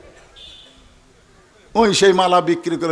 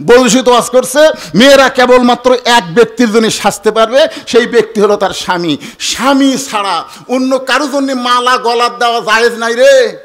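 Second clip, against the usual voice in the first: loud, raised preaching delivery; preacher's ruling; 6 semitones higher, 7 dB louder than usual. Acted. Husband quoting a sermon at his wife, loud and preachy. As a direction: proclaim it high and forceful.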